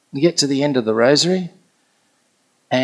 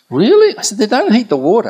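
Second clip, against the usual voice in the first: high, loud retold disbelief; incredulous; 9 semitones higher, 7 dB louder than usual. Speaker doubts friend's warm water story, incredulous. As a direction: shoot up in pitch, loudly disbelieving. Retelling own disbelief at the claim.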